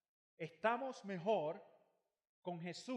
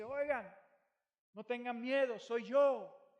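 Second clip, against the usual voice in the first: raised voice calling across the storm, reassuring; 6 semitones higher, 9 dB louder than usual. Retold or imitated. Jesus calls to the frightened disciples, loud and reassuring. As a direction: call out over the wind to calm them.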